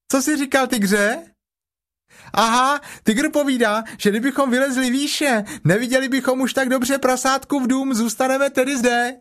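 very high excited joey voice; a delighted little kangaroo babbling; chattering Roo. A narrator's reading. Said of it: "Roo relays Tigger". (nadšeně štěbetající klokánek)

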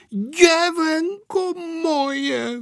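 very high, long-drawn exclamation; fond outcry, stretched wide; grandmother crying out. A narrator's reading. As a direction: cry it out slowly on a high note. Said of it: "vykřikla babička".

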